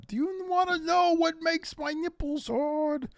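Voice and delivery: high, slow put-on voice; hammed-up Jericho quote. Host quoting a wrestler's catchphrase, high and slow. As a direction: drag it out in a mock showman voice.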